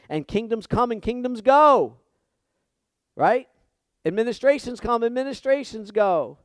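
high, loud preaching proclamation; preaching at full force. Acted. Pastor telling his church that kingdoms pass, loud and fervent. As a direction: hammer home the point.

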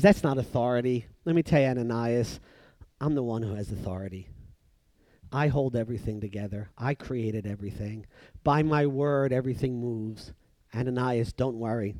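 low, calm, softened reassurance; the Lord, gentle. Acted. The Lord reassuring Ananias, gentle and low. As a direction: settle him quietly with unhurried assurance. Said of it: "very gently and nicely says".

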